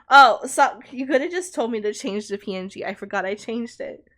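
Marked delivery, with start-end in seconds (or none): none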